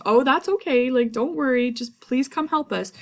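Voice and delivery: high, loud, eager coaxing; Macduff pleading. Retold or imitated. Teacher voicing Macduff urging Malcolm, high and coaxing. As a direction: coax him to come help. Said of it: "And Macduff says".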